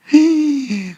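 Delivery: sharp, high gasp; acted startled intake of breath; shocked gasp. Shock at being bitten.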